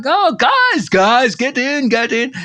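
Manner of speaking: hammed-up eager shout-out to hitchhikers; driver calling them in. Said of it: "he's like".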